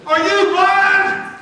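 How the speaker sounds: yelled at the referee, high and loud; furious coach-side yell; hollering. Retold or imitated. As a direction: holler it across the field. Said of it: "And I hollered".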